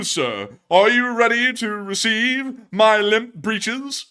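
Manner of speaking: high, loud, slow flustered gentleman; fluttering cries for help. Pretend. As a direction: splutter like a scandalized aristocrat. Succulent Chinese meal meme.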